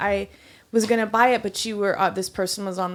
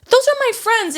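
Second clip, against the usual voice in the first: much higher, louder defiant yell; retold yell; 5 dB louder than usual. A server yelling at a coworker who tracks her table visits. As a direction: throw the defiance back at him.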